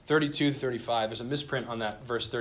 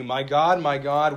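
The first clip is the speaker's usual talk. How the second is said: louder quoted cry, not a full shout; 7 dB louder, slower than usual. Retold or imitated. Preacher quoting Jesus on the cross, crying out loudly. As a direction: cry it out firmly on each "God". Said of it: "he cried out the beginning words".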